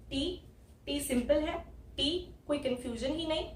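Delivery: normal talking voice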